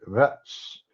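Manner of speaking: mock sneer on "Vits"; sneering imitation. Commentator imitating how Trump says votes, mock sneer. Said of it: "he always sneers when he says it".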